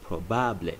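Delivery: probable as pro-BAH-bleh; Spanish probable, final e sounded. Shown as a demonstration. Teacher models es probable. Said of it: "is pronounced as es".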